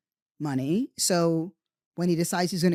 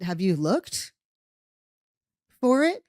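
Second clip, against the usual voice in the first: sarcastic retort; 2 semitones higher, 4 dB louder than usual. Retold or imitated. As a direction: needle him with a sarcastic question. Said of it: "And I said".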